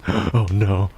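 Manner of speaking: ordinary talk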